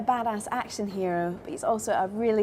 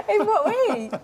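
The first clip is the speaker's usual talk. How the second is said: laughing; 13 semitones higher, slower than usual. Real, not acted. Laughing at Dolph's answer.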